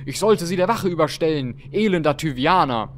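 loud, high, indignant nobleman; wrathful. Acted. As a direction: rage at an intruder in your house. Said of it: "Zorn ist wild".

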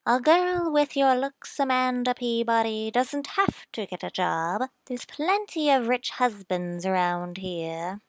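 a hint of an insistent older sister; lightly voiced eager sister; sister's voice. Sister Lizzie voiced in letter.

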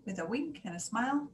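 usual speaking voice

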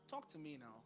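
normal talking voice

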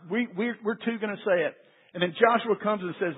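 normal talking voice

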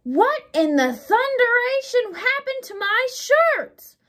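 high, loud, slow outraged roar; an angry husband roaring over ruined shirts. A narrator's reading. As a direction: bellow it at the housekeeper. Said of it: "Mister Rogers roared".

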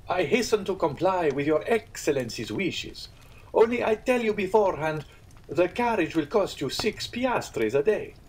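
high, obsequious landlord; innkeeper voice. Acted. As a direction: fawn while naming the price. Pastrini bargains.

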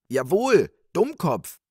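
high, loud scolding cry; wife calling out. Acted. Wife agreeing her husband is a fool, crying out. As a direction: call out sharply in a high voice. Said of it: "rief die Frau".

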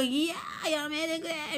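mock-plead like an overloaded machine; pleading washer. Washer begging its owner to stop, a lightly voiced plea. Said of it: "みたいな感じで言われて".

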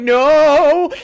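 loud, high, desperate no; panicked shout. Retold or imitated. Desperate man yells back at the helpful clerk, high and loud. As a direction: blurt it out in panic.

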